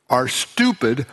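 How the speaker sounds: usual speaking voice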